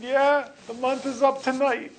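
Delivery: punchline guy. Acted. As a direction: deliver the twist with a wink. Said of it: "The guy said".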